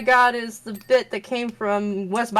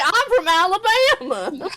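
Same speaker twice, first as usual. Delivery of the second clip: mock Alabama accent; 10 semitones higher than usual. Joking about being the Southerner, in a hammed-up Alabama accent. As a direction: play up a big Southern twang. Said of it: "with that kind of accent".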